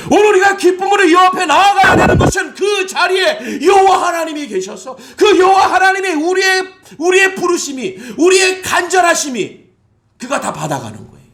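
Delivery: high, loud shouted sermon; fiery preaching. Acted. Pastor calling the church to come with joy, fiery preaching. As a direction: proclaim at full force.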